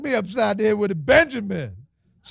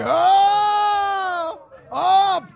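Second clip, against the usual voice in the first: excited whoop; 9 semitones higher than usual. Real, not acted. Host whooping as the bid jumps to seventy.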